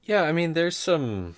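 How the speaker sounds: high bird-person voice; Aarakocra character voice. Acted. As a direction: speak as a bird creature. Player in character voice.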